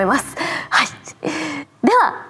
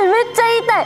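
excited; 11 semitones higher, faster than usual. Real, not acted. Eager to shout ippon.

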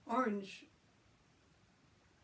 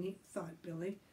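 ordinary delivery

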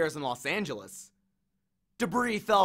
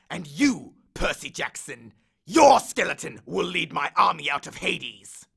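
menacing threat; 2 semitones higher, 6 dB louder than usual. Acted. Hades threatens Percy's world, loud menace. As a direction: promise doom.

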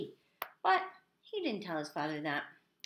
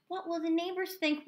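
high, fretting mother voice; worried mother; 9 semitones higher than usual. A narrator's reading. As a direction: fret aloud in a raised pitch. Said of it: "worried his mother".

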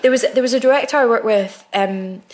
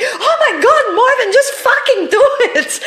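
much higher, louder, laughing mock outburst; exasperated director; 6 dB louder than usual. Director losing patience with Marvin, laughing exasperated. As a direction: blow up at the actor through laughter.

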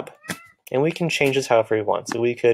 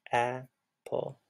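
"Apple." much softer, two beats; soft repeat; 11 dB quieter than usual. Shown as a demonstration. Teacher quietly repeating the word for the class. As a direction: repeat "Apple." gently, nearly under breath. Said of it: "Two sounds".

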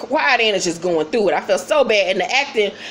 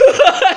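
loud, high cackling laugh; real burst of laughter; 9 semitones higher, 7 dB louder than usual. Real, not acted. Reviewer cracking up at the insult, loud high laughter. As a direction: crack up mid-story.

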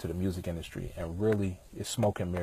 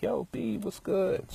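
lightly voiced friend, higher and excited; a hint of his old friend calling out, pitched up; old friend greeting; 12 semitones higher, slower than usual. Friend Charisma spotting him on the street, lightly imitated higher-pitched greeting. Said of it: "she was like".

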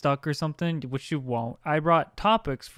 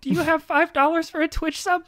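put-on high cute anime girl; 8 semitones higher than usual. E-girl role.

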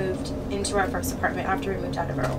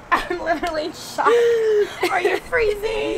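crying; 10 semitones higher, 7 dB louder than usual. Real, not acted. Emotional reunion.

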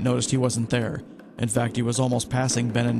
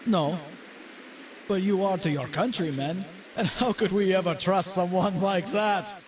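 high-pitched German officer voice; U-boat captain yelling; 7 semitones higher than usual. A narrator's reading. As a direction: call out the accusation, pitched high. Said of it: "he yelled".

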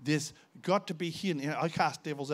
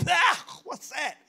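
sudden loud high "Ah" of shock; startled cry; 13 semitones higher, 6 dB louder, much slower than usual. Retold or imitated. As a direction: yelp in disgust and surprise. Foul snake-like thing leaving mouth.

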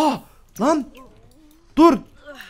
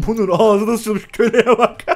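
laughing; 5 semitones higher, slower than usual. Real, not acted. Laughing at the game Sim.

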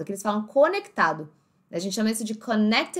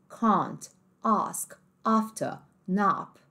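can't, ask, after, nap with the open British A, kahnt; British accent; much slower than usual. Shown as a demonstration. Teacher lists the same words in British English. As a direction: open British A on every word. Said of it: "No inglês mais britânico".

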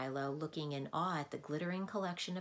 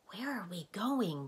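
ordinary delivery, nothing marked

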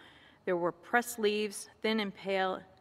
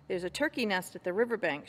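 ordinary delivery

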